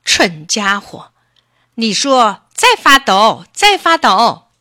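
loud, slow, scolding dictation; angry fox. A narrator's reading. Fox makes the rabbit repeat the words, angrily. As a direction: drill it into a stupid pupil. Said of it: "狐狸又生气了".

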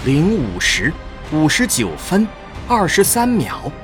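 normal talking voice

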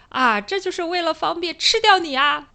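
a hint of the sly wolf in granny's clothes. Retelling the old fairy tale, a hint of the sly wolf. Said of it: "然后这个狼外婆就说".

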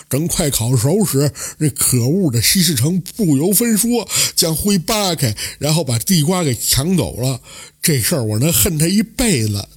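a touch of crying in a simpleton's voice; tearful complaint. A narrator's reading. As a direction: whine out the sweet-potato grievance. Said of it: "哭着说道".

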